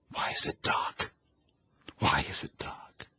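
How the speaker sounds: breathless, voiceless whisper of dread; frightened whisper. A narrator's reading. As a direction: whisper it in the dark, terrified. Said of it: "thought screamingly".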